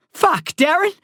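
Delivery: put-on boy hollering at his friend to stop; hollered. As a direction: shout his name in panic. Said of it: "I hollered".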